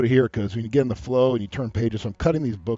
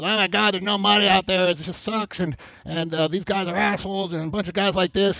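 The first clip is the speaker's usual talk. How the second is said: higher-pitched mock whining girl; high, hammed-up griping; complaining girl; 6 semitones higher than usual. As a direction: imitate a girl venting, pitch held up high. Imitates a girl complaining at work.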